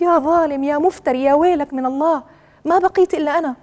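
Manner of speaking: high, heated string of accusations; acted angry reproach. Fourth wife rails at unjust husband, acted angry reproach.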